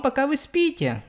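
ordinary talk, nothing marked

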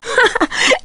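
short, high laugh; amused laugh. Acted. Reacting to something unbelievable, amused laugh. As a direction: give a quick high-pitched laugh.

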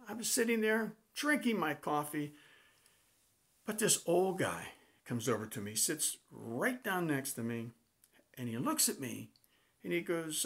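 ordinary talk, nothing marked

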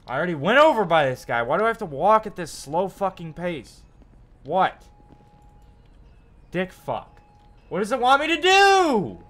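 loud, high furious ranting; raging. Real, not acted. Frustrated gamer shouting at the mission, furious rant. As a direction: blow up at the game, real rage.

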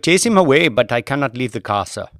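put-on a driver anxiously excusing himself to his passenger, Indian accent.

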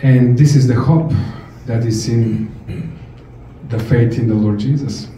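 ordinary talk, nothing marked

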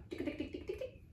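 quiet voiceless tick-tick noises; imitated ticking. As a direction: tick softly like a spinning prize wheel. Imitates ticking while scrolling.

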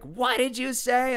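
slow, high-pitched mock outrage; outraged mom. Friend's mom challenging a cheeky kid, outraged.

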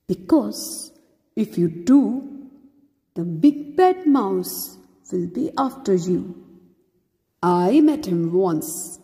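put-on deep dad warning his child; Gruffalo dad. A narrator's reading. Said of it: "the Gruffalo said".

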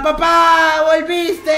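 overjoyed shout with a sob; excited cry. Acted. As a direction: cry out in delight. Son greets father back.

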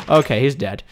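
usual speaking voice

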